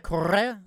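'correr' with the double R trilled; rolled R. Shown as a demonstration. Asking if he can roll R's, trilled 'correr'. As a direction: trill the middle of 'correr', Spanish style. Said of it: "Can you roll your R's?".

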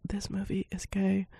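whispered, conspiratorial; a whispered confession; whisper aside. Real, not acted. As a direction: lean in and whisper it. Aside confiding the movie's subtext.

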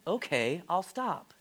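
normal talking voice

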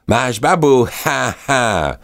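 high, gloating gull cackle; teasing gull. Acted. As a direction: tag her and laugh like a rude gull. Gull tags Alba. (vysoký škodolibý racek)

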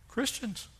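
normal talking voice

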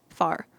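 "far" said Texas style, standing for both far and fire; Texas vowel merging. Shown as a demonstration. Vlogger showing Texas vowel merging, "far" for fire. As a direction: say "far" as a Texan says "fire". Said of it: "far and fire both sound the same".

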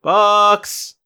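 mild in-character call for a pet. Acted. Wizard calling for his missing owl, mild shout. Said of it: "shouting in the hallway for Bucks".

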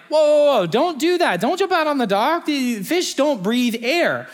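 high, urgent warning from worried friends; voiced fish friends pleading, pitched high; alarmed fish friends. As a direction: cry out to stop a friend jumping.

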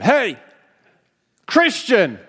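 high, loud mock yell down a hallway; imitating a coworker's loud taunting yell; retold yell. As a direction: call out across the hall like a heckler. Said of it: "he yelled at me down the hallway".

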